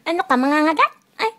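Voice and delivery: scolding the dog that might bite, loud. Real, not acted. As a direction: bark it out at the dog. Dog acting like it will bite.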